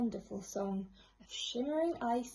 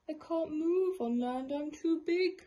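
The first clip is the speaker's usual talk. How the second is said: moaning whale; 5 semitones higher, 5 dB louder than usual. A narrator's reading. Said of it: "moaned the whale".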